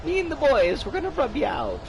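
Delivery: put-on high old-time gangster voice; nya speak. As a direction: threaten like a cartoon mobster. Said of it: "a fish that says nya".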